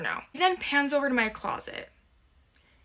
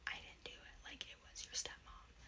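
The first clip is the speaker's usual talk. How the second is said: unvoiced whispered confession; 25 dB quieter than usual. Dad whispering to his daughter that stepmom did it. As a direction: whisper it like a guilty secret.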